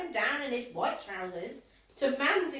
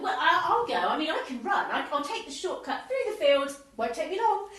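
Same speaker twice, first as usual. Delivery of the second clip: loud, eager, talking over the others; eager insistence; 4 semitones higher, 6 dB louder than usual. Acted. Members racing to rescue the coach, loud and eager. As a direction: elbow in first, loudly volunteering.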